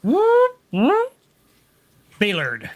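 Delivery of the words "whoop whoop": high rising siren whoop; alarm whoop. Acted. Game master sounding the alarm as the lights go orange, rising whoops. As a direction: whoop like an alarm, pitch sweeping sharply up.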